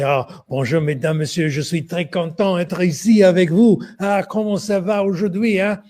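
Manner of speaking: gushing caricature of a French speaker; exaggerated French accent. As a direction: ham up the French accent like a mocking schoolboy. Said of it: "foolishly exaggerate a French accent".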